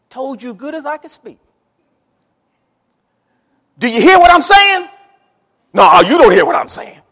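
put-on a father yelling at his boy during a whipping; angry father. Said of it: "what my daddy would say to me while he was beating me".

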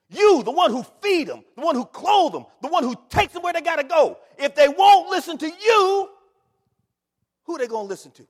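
high, loud preacher's cry; rising revival preaching; full-force preaching. Acted. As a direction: preach it out to the church.

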